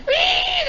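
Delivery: loud, piercing imitated whine; long high wire squeal. Imitating the chute catching the wind, high squeal. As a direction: squeal like a runaway winch wire.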